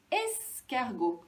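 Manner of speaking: "escargot" slow, the es held; first syllable isolated. Shown as a demonstration. Teacher introducing the next picture, first syllable isolated. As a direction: stretch es before the rest of "escargot". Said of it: "Au début de escargot".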